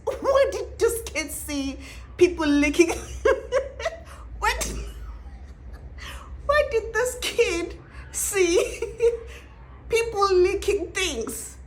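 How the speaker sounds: slow, high, laughing question; laughing disbelief. Real, not acted. Vlogger asking viewers in amused shock: laughing. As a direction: drag it out through laughter.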